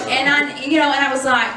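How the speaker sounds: ordinary delivery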